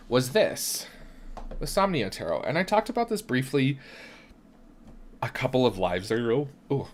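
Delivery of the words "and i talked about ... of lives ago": talking through hiccups. Real, not acted. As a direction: hiccups breaking the words. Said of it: "I've got, like, the hiccups".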